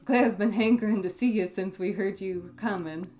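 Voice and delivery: put-on folksy country accent, proud of his helper; lightly gruff, folksy accent of a foster father. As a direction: play a country shopkeeper with a drawling accent. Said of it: "give him an accent".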